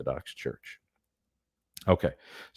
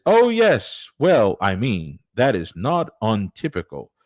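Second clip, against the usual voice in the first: hammed-up English accent, higher-pitched and lilting; amateur English accent with swooping high pitch; 6 semitones higher than usual. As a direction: put on a bad English accent. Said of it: "Sorry for my terrible English accent".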